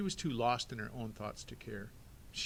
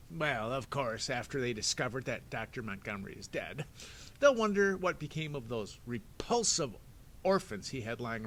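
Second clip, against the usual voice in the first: sneering villain; 4 dB louder than usual. Acted. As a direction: gloat like a smug crook. Stefano villain voice.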